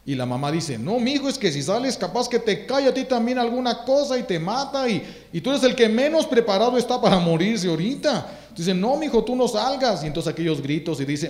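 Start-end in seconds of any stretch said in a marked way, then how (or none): 0.8-8.4 voz de madre más aguda, rogando; madre asustada. Retold or imitated. Madre impide salir al hijo. 8.7-10.0 voz de madre más aguda, rogando; madre asustada. Retold or imitated. Madre impide salir al hijo.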